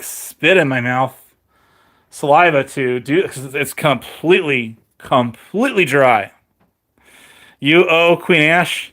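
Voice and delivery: normal talking voice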